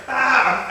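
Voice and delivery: loud high ah mocking; mock goofy yell. Partner mocking his yell, loud high cry. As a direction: ham up a pained yell. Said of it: "making goofy".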